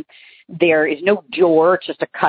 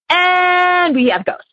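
slow, drawn-out and much higher, like a campfire ghost story; milk the punchline with a mock-eerie voice; hammed-up eerie announcement; spooky reveal; 13 semitones higher, much slower than usual. Dramatic reveal of a ghost coin.

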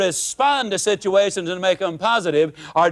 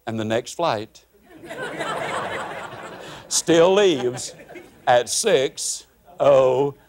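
slow deadpan punchline; 8 semitones lower, slower than usual. Real, not acted. To the audience after the rant, a slow deadpan. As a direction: let the last words hang slowly. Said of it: "punchline repeated after rant".

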